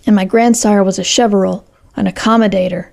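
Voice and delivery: ordinary delivery, nothing marked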